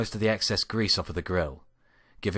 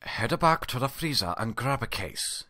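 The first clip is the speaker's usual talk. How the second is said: growled; 5 semitones higher than usual. Acted. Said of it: "he growled".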